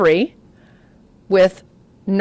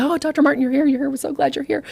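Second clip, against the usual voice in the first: a nurse gushing with relief, higher; relieved nurse; 6 semitones higher, faster than usual. Retold or imitated. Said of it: "the nurse is like".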